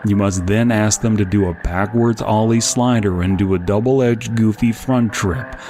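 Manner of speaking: ordinary talk, nothing marked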